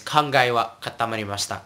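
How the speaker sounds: quick, run-together "katamarimashita"; said fast. Shown as a demonstration. Teacher repeats the example phrase, fast. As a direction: rattle off "kangai wa katamarimashita", the final i dropped. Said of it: "they say it faster".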